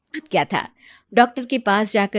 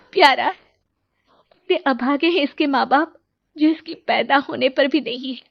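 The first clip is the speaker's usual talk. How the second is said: tender sorrow; 4 semitones higher than usual. Acted. Mother cradles newborn, sad.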